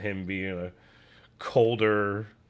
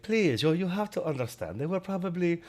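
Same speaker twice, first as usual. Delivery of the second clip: put-on high, pleading foreign voice with a German accent; pleading German guy; 9 semitones higher than usual. German guy pleading with Mike over drunk people, high and desperate. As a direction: beg him to understand, pitched way up. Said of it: "'Cause he's like".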